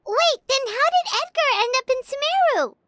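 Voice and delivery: very high-pitched, startled question; puzzled surprise. Acted. Companion questioning the merchant's story, surprised. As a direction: cut in with a squeaky, baffled question.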